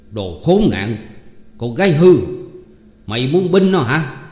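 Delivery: loud, furious scolding, pitch rising; angry yell. Acted. Hai Quá yelling at the girl who defends Sáu, furious shout. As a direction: bark at the girl in rage.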